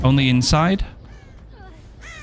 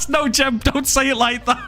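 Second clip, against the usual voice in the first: laughing protest; 10 semitones higher than usual. Real, not acted. Laughing at friend.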